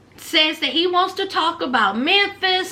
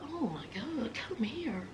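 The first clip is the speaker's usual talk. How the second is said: whispered; 5 semitones lower, 18 dB quieter than usual. Retold or imitated.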